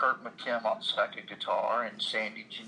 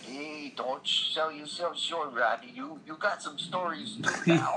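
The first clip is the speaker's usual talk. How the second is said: put-on light New York accent, a bit higher; 4 semitones higher than usual. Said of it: "in his New York accent".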